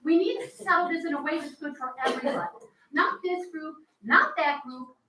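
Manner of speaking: loud, near-shouting plea; shouting at the board, pushing past restraint; heated shouting. Real, not acted. Heated plea at town meeting.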